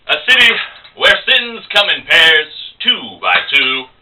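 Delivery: high, slow, grandiose declaiming; pretentious barroom poet, pitched high; would-be smart guy. Acted. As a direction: show off with drawn-out poetic airs. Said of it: "he's trying to look smart".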